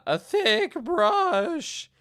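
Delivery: high, slow, whiny crying parody; mock him as a weepy, whiny guru; mock Jordan Peterson. Parody voice again.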